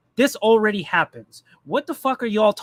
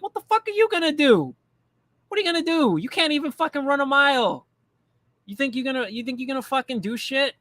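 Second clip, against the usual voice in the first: raised mocking challenge, higher pitched; incredulous mockery; 6 semitones higher than usual. Talking back to an overweight civil war larper, higher mocking challenge. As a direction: scoff at the overweight guy, voice pitched up. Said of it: "And I was like".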